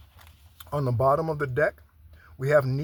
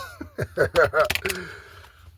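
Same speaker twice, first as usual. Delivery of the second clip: laugh; 4 semitones higher, 6 dB louder than usual. Real, not acted. Laughing at her own joke.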